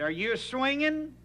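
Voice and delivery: high, slow call across the yard; father calling a question to his son. Retold or imitated. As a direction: shout the question out to the boy.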